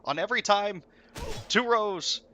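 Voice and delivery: loud, high rapid-fire commands; raid leader callouts. Retold or imitated. Raid leader barking orders mid-fight, loud and high. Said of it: "he's like".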